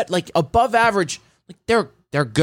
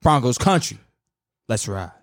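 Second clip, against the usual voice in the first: low-key, lower take on the catchphrase; Russell Wilson catchphrase take; 6 semitones lower than usual. Shown as a demonstration. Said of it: "I'm doing two of them".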